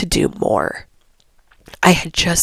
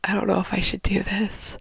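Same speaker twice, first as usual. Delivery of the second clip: hoarse, whispered doubt; soft unvoiced whisper, sick voice; 6 dB quieter than usual. Real, not acted. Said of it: "my voice is really bad".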